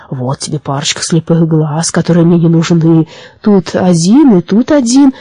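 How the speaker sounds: plain reading voice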